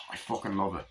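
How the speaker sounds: usual speaking voice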